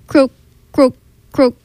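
bellowing bullfrog. A narrator's reading. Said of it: "The bullfrog bellowed".

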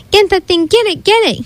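high-pitched, repeated urging; panicked demand. A narrator's reading. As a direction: beg for it to be caught. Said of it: "he demanded as he jumped up".

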